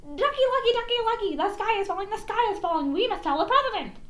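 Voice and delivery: high, frantic, fast hen voice; panicked chicken yelling to a friend; clucking hen. A narrator's reading. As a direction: flap and cluck the warning. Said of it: "and clucked".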